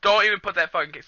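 normal talking voice

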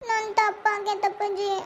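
slow, tearful confession; crying. Acted. Child admits a mistake to father, crying. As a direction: drag the words out through tears. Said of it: "ಛೇ, ಯಾಕ್ ಅಳ್ತೀಯವ್ವ".

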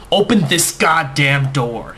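slow, loud furious roar; roaring demand. A narrator's reading. Fiancée pounding on the bedroom door, slow roaring rage. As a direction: roar it through the locked door like a beast. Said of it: "like a reptilian lion roaring".

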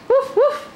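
dog imitation. Shown as a demonstration. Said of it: "what's the puppy say?".

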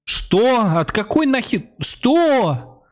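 sudden high-pitched, loud what?!; shocked streamer cutting in on the cutscene; startled outburst. Real, not acted. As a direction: blurt it out in disbelief. Streamer reacts to cutscene line.

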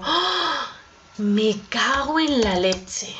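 a slow, dragged-out groan of annoyance; mock fed-up outburst; exasperated curse. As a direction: curse the forgotten party slowly. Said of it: "Y yo digo".

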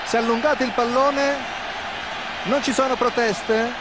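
ordinary talk, nothing marked

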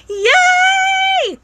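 long, high squealed cheer; excited scream. Real, not acted. Streamer celebrating the dog food find, excited scream. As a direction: let out a real, drawn-out happy shriek. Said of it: "Sorry if I screamed really loud".

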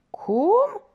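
a sharp high, loud cry of shock; astonished. Acted. Porter stunned by the young man's news, shocked cry. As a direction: jolt up in pitch, stunned. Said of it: "se miră nea Costache".

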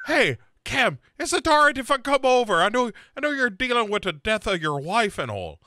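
high, loud, rushed mock sweetness; mock Sarah. Friend mocking Sarah texting the widower, high and gushing. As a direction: gush it out fast and high. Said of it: "Sarah texts him and is like".

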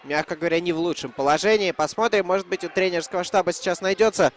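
ordinary talk, nothing marked

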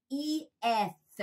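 "E S" said as two drawn-out sounds, ee-eth; separated ending. Shown as a demonstration. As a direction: model the ending "E S" slowly in separate beats. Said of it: "ちょっと言いにくいから". (「E S」をイー・エスと区切って発音)

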